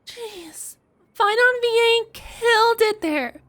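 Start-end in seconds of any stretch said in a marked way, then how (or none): none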